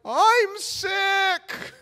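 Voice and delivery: very high, drawn-out wail; panicked mock excuse. Teen refusing to jump in, squealed panicky excuse. Embarrassed teen's excuse not to strip.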